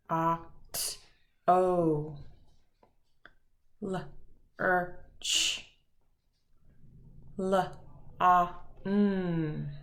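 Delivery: softly sounding out each word sound by sound; quiet sounded-out phonemes; segmented phonemes. Shown as a demonstration. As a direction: break the word into separate sounds. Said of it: "say the phonemes for each word".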